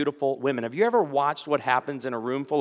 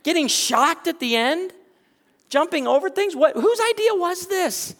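excited retelling; 14 semitones higher, 5 dB louder than usual. Retold or imitated. Retelling excited couple at wedding.

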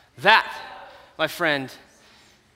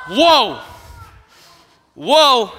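impressed whoa; 3 semitones higher, 5 dB louder than usual. Retold or imitated. Hearing a Mustang is coming.

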